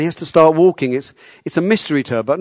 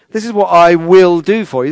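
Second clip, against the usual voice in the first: "will" punched louder and higher; emphatic "will", raised and forceful; stress on WILL; 4 semitones higher, 6 dB louder than usual. Retold or imitated. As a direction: lean hard on "I will" as a firm promise. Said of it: "that's really strong, the "I will".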